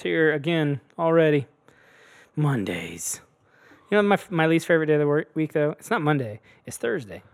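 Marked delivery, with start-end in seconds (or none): 2.4-3.2 hushed dread; whisper. Real, not acted. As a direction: breathe it like a secret. Dreading Mondays aside.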